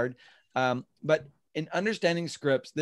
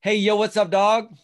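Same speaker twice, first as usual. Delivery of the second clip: louder, higher buddy-style hey yo; casual slang greeting; 6 semitones higher, 8 dB louder than usual. Shown as a demonstration. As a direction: greet like a street pal. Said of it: "Script number three is".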